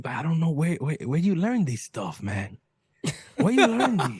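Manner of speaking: a hint of an exasperated dad; puzzled dad, pitch climbing; dad asking where his son learned to talk like that; imitating his dad. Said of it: "My dad always, he just".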